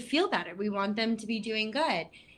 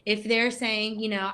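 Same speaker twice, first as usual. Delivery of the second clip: ordinary talk, nothing marked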